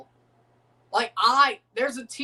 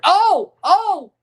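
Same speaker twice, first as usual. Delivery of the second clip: sudden loud, high gasping yell; shocked shout; 11 semitones higher, 10 dB louder than usual. Real, not acted. Streamer watching Raul go down in the box, shocked yell. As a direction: burst out at the near goal. Near-penalty chance in match.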